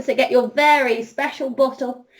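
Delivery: normal talking voice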